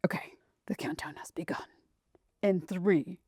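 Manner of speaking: soft unvoiced whisper, dramatic launch hush; hushed countdown whisper. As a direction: breathe the countdown like mission control.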